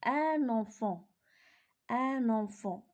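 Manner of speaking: "Un enfant" drawn out slowly; slow teacher reading; slow model reading. Shown as a demonstration. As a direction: model the phrase slowly for the class. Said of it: "je lis les groupes des mots".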